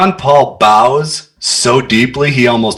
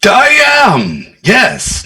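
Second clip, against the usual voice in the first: eager instant yes; 9 semitones higher, much slower than usual. Acted. Smitten John Paul eagerly answering the lady at once. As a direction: jump straight in, pitched up high and eager. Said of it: "No pause on that one".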